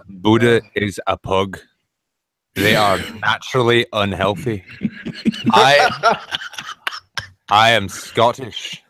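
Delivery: put-on Scottish accent. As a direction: say it like a proud, unbothered Scot. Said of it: "you can do his accent".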